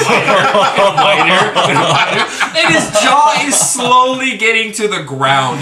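can barely get it out for laughing; cracking up mid-story. Real, not acted. Laughing while telling the prank.

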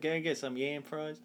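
mock country accent, softly; hint of a country accent. Shown as a demonstration. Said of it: "say it in, like, a country accent".

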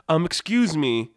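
a really nice guy asking politely; lightly voiced, mild-mannered polite interruption; Toucan Sam. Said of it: "like, this really nice guy".

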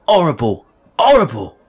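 high, loud cries of horror; horrified cry. Acted. Boy hearing mother would hang, horrified. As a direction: cry out in boyish horror, mounting on the repeat.

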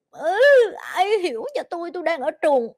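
high, whiny voice of a naked newborn; mock newborn lament. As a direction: play a baby complaining nobody understands it. Voicing a crying newborn.

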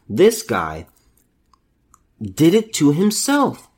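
ordinary delivery

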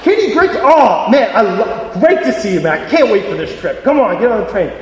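high, excited hello to a friend; eager, stumbling-over-words welcome; overjoyed greeting. Acted. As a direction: burst out with delight at seeing your mate. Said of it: "How does he react when he sees Kenny?".

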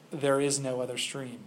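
a hint of a grave lion, quietly certain; solemn lion. A narrator's reading. Lion's final solemn answer.

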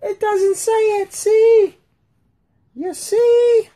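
high-pitched mock female complaint; mocking women's voice. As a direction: whine it back like a mocked wife. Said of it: "Now the women are gonna be like".

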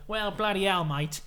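loud, exasperated Australian outburst; hammed-up annoyed Aussie gambler. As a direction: blurt it out loudly, fed up. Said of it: "Scott's so annoyed".

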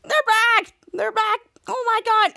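high-pitched panicked cries; freaking out. Retold or imitated. Henry seeing the hornet's nest is back, freaking out. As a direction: imitate someone panicking, high and urgent. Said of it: "Henry's freaking out".

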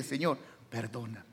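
soft, low plea for forgiveness; humble plea. Acted. Preacher models asking God for forgiveness, humble and soft. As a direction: drop to a hushed, humble prayer.

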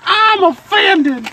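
high, loud, drawn-out mock outrage; mock offended. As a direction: wail it like a fake diva. Mock outrage after feeling attacked.

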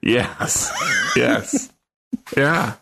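yes through a laugh; laugh, then agree. Real, not acted. Laughs at flattering remark.

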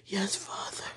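soft unvoiced whisper to God; whispered prayer. Real, not acted. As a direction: breathe it as a prayer.

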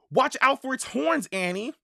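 high-pitched worried warning; urgent, high caution; anxious warning. A narrator's reading. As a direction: warn her in a high, anxious voice. Boy warns sister about horns.

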